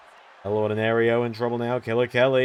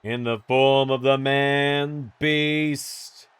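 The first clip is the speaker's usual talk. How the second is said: ring announcer. Acted. Introduces wrestler entrance.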